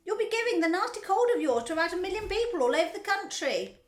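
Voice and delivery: high, loud scolding mother; shouting. A narrator's reading. Mother telling off her son over his cold, shouting. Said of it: "shouted Missus Gloop".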